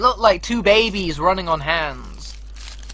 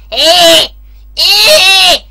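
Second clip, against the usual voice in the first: put-on loud, higher yelps like a strange kid; imitated kid yelping; 9 semitones higher, 12 dB louder, much slower than usual. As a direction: bark out the kid's odd noise. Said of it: "he goes".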